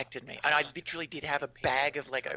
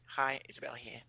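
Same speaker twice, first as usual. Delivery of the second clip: soft breathy hello; whisper; 6 dB quieter than usual. Real, not acted. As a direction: whisper the greeting. Whispered self-introduction.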